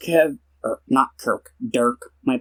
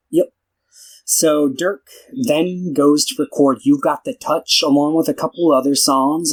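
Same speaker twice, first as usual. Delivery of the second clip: usual speaking voice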